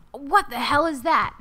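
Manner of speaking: sudden startled question; surprised. Real, not acted. Sees strange item in game.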